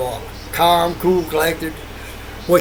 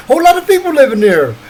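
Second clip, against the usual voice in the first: excited; 8 semitones higher, 8 dB louder than usual. Real, not acted. Excited, laughing about address.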